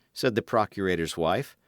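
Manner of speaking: usual speaking voice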